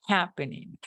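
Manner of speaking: "happening" with emphasis on its first syllable; stress on first syllable. Shown as a demonstration. Teacher showing students first-syllable emphasis, stressing the start of "happening". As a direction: lean on the opening syllable of "happening". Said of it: "hacer un énfasis en la primera sílaba".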